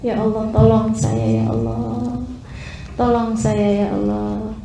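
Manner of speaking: hushed, low plea to God; pleading prayer. Acted. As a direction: plead softly and helplessly, as if praying alone. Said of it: "Kamu berdoa manggil nama Allah". (doa lirih memelas)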